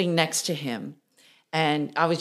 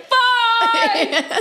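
high, loud, laughing "Bye!"; gleeful goodbye; 7 dB louder than usual. Retold or imitated. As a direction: shout a giddy farewell as you run off. Ditching the band for the limo.

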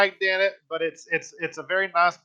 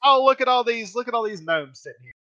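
mock delight; 7 semitones higher than usual. Parents spotting gnomes in the yard, mock delight. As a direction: act out a delighted discovery.

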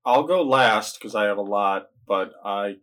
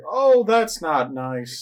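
high, drawn-out mock dismay; 7 semitones higher, much slower than usual. Viewer reacting to film gore, mock dismay. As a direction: stretch it out slowly in a raised voice.

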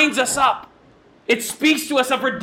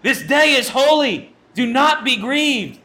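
raised, higher voice, firm command; leaders hushing the crowd; 5 semitones higher, 3 dB louder, slower than usual. Retold or imitated. Leaders telling the weeping people to hush, raised and firm. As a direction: call out over a weeping crowd. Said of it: "the leaders are like".

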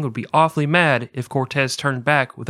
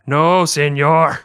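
"No, señor" stretched slow and a bit louder; drawn-out emphatic no señor; 3 semitones higher, 6 dB louder, much slower than usual. Shown as a demonstration. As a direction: drag out "No, señor" with weight. Repeating phrase two ways.

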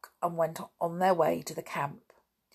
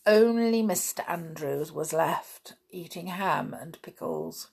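usual speaking voice

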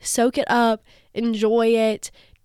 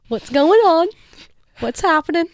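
higher, louder questioning; retold concern; 6 semitones higher, 3 dB louder than usual. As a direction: press for answers, acting out a worried call. Retold worried call to friend.

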